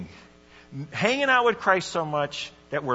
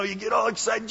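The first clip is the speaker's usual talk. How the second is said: normal talking voice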